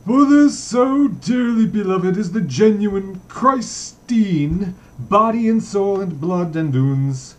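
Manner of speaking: hammed-up priest preaching to the congregation; preacher's tone. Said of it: "He added in a preacher's tone".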